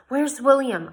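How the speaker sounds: high, loud urgent demand. A narrator's reading. Jane demands of Yella where William is. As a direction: demand an answer. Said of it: "I demanded".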